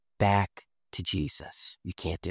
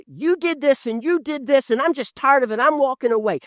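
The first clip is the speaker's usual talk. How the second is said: mock accusation; 11 semitones higher than usual. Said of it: "point their finger at you and say".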